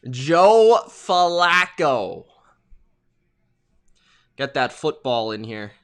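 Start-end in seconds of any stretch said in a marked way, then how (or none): 0.1-2.3 very slow, stretched-out name; drawn-out announcement. Real, not acted. Streamer announcing the quarterback taking the field, drawn-out announcement. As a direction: draw each syllable way out like an announcer.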